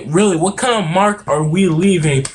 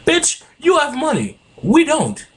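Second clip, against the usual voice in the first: indignant outburst; 10 semitones higher than usual. Real, not acted. Ranting at rich people who dodge taxes, high indignant snap. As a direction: pitch jumps way up in indignation.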